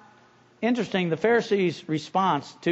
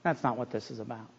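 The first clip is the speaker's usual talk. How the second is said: much softer, lower restatement; soft repeat; 8 semitones lower, 10 dB quieter, faster than usual. Real, not acted. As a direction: drop the voice to drive it home. Repeated point in sermon.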